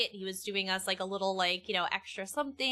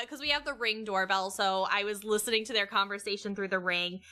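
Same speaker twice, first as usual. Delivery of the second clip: ordinary talk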